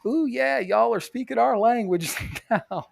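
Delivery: high, slow hammed-up gushing radio voice; mock radio DJ gushing to truck buyers; mocking radio host. Imitating the local radio host gushing over trucks. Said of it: "She's like".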